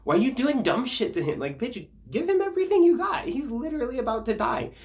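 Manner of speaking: high, loud, fast incredulous rant; exasperated rant. Retold or imitated. Scolding the apostles for doing dumb shit, incredulous rant. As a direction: fire it off in disbelief. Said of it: "being like".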